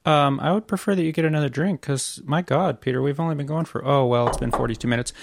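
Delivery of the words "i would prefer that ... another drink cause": usual speaking voice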